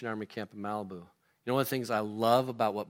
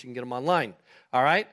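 normal talking voice

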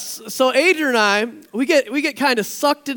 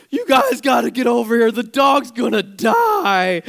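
loud, frantic retold plea; hysterical plea; 4 dB louder than usual. Friend panics over her dying dog, loud hysterical plea. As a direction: mimic a panicked friend begging. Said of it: "Kathy is just hysterical".

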